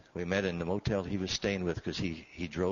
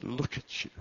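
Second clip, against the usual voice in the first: a hint of tearful; 5 semitones higher than usual.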